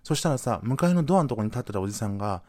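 ordinary talk, nothing marked

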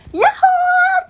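高く張った元気いっぱいの「ヤッホー！」; 箱から飛び出すように大声で叫ぶ; 元気よく. Acted. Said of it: "元気よく飛び出してく". (元気よく飛び出すヤッホー)

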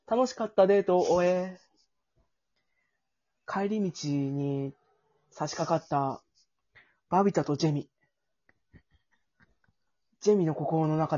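slow, measured storyteller voice; slow story narration. Acted. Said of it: "ナレーションから失礼いたします".

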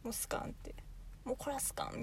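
hushed whisper; breathy whispered dialect word. Real, not acted. (ささやき声)

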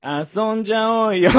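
high, loud, stretched-out pickup line; Yosuke Kubozuka. Retold or imitated. Junior inviting a woman out, imitated brash cool-guy call. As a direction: mimic a cocky yankee, slow and loud. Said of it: "もうほんと窪塚で行ったわけ？".

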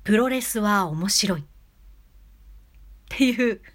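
dramatic pause before the next word; pause demo. Shown as a demonstration. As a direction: hold a long pause after プロレスは面白い. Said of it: "次、間。".

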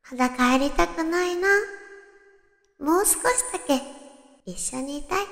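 put-on soft, pleading girlfriend voice; 甘えた彼女の声. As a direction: coax him to stay a little longer. 胸キュンゼリフを甘えて演じる. (甘えるようにささやく声)

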